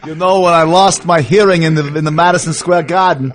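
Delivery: lightly imitated Yiddish accent. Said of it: "Rabbi Bruno Sammartino Berg".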